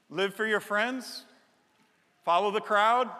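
ordinary talk, nothing marked

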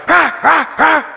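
a light imitation of a rude guy's grumbling; mocking a grumpy passenger. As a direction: ham up a complaining man on a plane. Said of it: "when the guy's going".

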